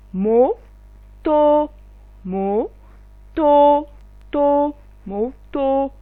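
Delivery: To, moto étiré lentement, syllabes allongées; détacher To puis moto au ralenti; lent, étiré. Shown as a demonstration. Teacher slows model word. (la maîtresse étire To, moto très lentement)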